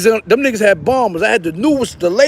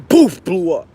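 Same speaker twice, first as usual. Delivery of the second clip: ordinary talk, nothing marked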